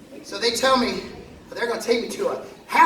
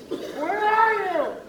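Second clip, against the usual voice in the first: calling out; 10 semitones higher, much slower than usual. Retold or imitated. Calling to a higher power.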